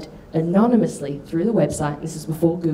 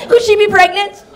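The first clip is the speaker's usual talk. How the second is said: high, loud schoolgirl letter voice; mock letter writer; 14 semitones higher, 9 dB louder than usual. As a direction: read it out as an over-earnest, high-pitched plea. Reads out her childhood prank letters.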